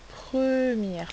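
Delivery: emphatic teacher's stress on "première"; drawn-out stress. Shown as a demonstration. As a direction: lean long and high on the start of "première". Teacher stressing the answer's position.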